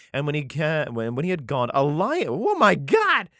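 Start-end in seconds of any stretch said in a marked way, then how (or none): 2.3-3.3 surprised. Real, not acted. Reacting to the lion.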